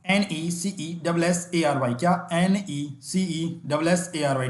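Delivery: slow dictation of the letters; slow spelling dictation. Shown as a demonstration. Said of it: "देखते हैं necessary की correct spelling".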